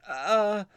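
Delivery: pained high grunt. Retold or imitated. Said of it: "and I was like".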